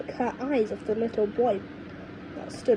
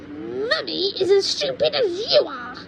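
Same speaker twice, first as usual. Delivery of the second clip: put-on high, cranky old woman lecturing; cranky grandma; 8 semitones higher, 4 dB louder than usual. Grandma scolds George about growing, in a high cranky voice. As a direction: scold like a mean little granny.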